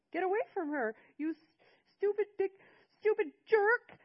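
put-on high, loud screaming boy's voice; a twelve-year-old shrieking in rage at the grandfather; screaming kid, voice cracking with fury; furious child yelling. A narrator's reading. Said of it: "Seth yelled at my father".

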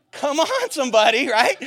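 high, loud call to the congregation; rally them, loud and high; call-out to crowd. Real, not acted. Preacher rallying the church, loud high call-out.

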